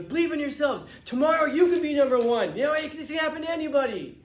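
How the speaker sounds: rally the crowd like a motivational speaker; inspirational speech. Retold or imitated. Winner preaching to the restaurant customers, loud rousing pep talk. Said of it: "then he starts telling us all".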